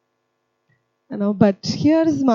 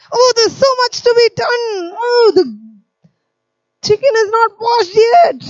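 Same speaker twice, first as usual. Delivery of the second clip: loud, much higher martyred sighing; hammed-up self-pitying grumble, higher; 11 semitones higher, 4 dB louder than usual. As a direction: pointedly complain so she hears. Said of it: "murmur to myself a little loudly".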